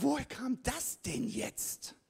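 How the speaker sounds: high, slow baffled question. Retold or imitated. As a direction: sound caught off guard by yourself. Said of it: "Und ich so".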